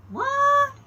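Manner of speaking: high squeak of disbelief; astonished what. Pretend. Peter, startled to find himself on a wall, squeaking in disbelief. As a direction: jump way up in pitch, caught off guard.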